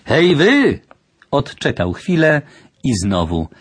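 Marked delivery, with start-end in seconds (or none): 0.1-0.8 high-pitched, half-held-back hail; uncertain shout. A narrator's reading. Stranger hails whoever may be behind empty windows, hesitant shout. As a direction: hail them higher, without full force. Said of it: "niepewnie jakoś krzyczał".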